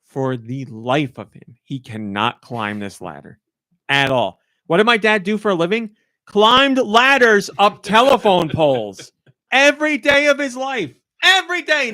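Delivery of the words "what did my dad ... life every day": loud, high, emphatic comic rant; incredulous outrage. Real, not acted. Daughter on ladder-climbing dad failing in game, incredulous. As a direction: build higher, hammering each phrase.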